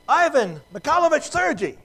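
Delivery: high, loud exclaimed Russian full name; Russians greeting by full name. Retold or imitated. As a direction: call out Ivan Mikhailovich Sergi with ceremony. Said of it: "and they said".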